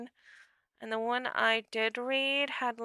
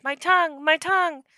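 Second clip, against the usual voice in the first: loud, higher-pitched character yelp; alarmed cry; 6 semitones higher, 9 dB louder than usual. A narrator's reading. Creature crying out about its tongue, loud alarmed yelp.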